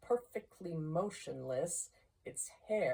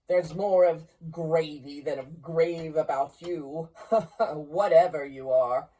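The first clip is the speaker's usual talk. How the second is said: put-on loud, defiant old miser scoffing at a ghost; Scrooge blustering; 7 dB louder than usual. Scrooge mocking the ghost to hide his fear, loud bluster. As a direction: bluff it out, louder than you feel.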